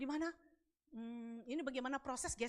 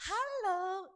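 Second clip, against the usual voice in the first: high, loud sing-song hello; put-on sweet greeting; softened, drawn-out greeting; 8 semitones higher, 8 dB louder than usual. Shown as a demonstration. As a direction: stretch out a sugary hello. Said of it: "kayak dilembeng-lembengin gitu ya".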